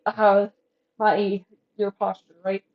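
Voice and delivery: ordinary talk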